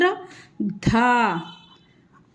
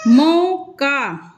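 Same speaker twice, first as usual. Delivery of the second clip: मौका stretched slowly, the au in मौ held long; slow dictation of मौका; slow demo word; much slower than usual. Shown as a demonstration. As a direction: spell out मौका slowly for the class. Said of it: "मास में औ का sound".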